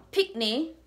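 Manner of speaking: normal talking voice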